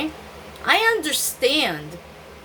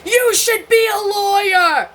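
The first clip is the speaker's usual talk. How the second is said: much higher, loud, strained cry-like line; father's loud retort; 10 semitones higher, 7 dB louder than usual. Retold or imitated. Father telling fourteen-year-old daughter, loud and strained. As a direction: throw the line back as her father did. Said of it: "he said".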